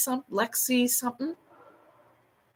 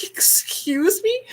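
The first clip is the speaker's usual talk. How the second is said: higher, slow, offended excuse me; indignant disbelief; 11 semitones higher, much slower than usual. Retold or imitated. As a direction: drag it out, taken aback. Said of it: "And the girl's like".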